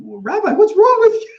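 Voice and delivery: high, loud imitation breaking into laughter; laughing, high-pitched mock concern; baffled congregants. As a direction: mimic worried congregants while cracking up. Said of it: "looking at me thinking".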